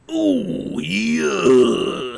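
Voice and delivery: drawn-out growled wrestler cheer; hammed-up wrestler roar, starting high; Macho Man catchphrase. Streamer reading a viewer's comment, hammed-up wrestler growl.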